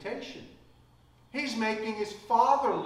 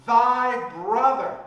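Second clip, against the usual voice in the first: thy brother slow, much louder and higher; stress on thy brother; 6 semitones higher, 10 dB louder, slower than usual. Shown as a demonstration. Father reclaiming the brother, loud and slow stress. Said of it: "see what the, he says?".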